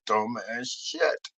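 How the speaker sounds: low, soft "dumbass shit"; muted disparagement. Acted. Comic mocking what Santi tries, low and dying away. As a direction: let "shit" fade away almost voiceless. First, low take of phrase.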